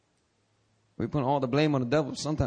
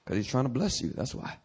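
usual speaking voice

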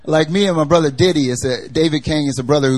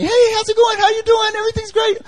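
much higher, chirpy mock voice of cheerful Kathleen; overly happy Kathleen. Preacher mimicking a relentlessly cheerful friend, sing-song high. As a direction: squeal it like someone too happy. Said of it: "Kathleen was always, like, so happy".

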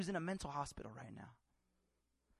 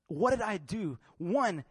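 ordinary delivery

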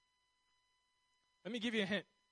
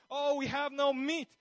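drawn-out, raised mock whine; grumbling; 5 semitones higher, 6 dB louder than usual. Israelites complaining to Moses about food, mock grumbling. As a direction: grumble slowly and loudly, hammed up. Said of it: "they're grumbling all the time".

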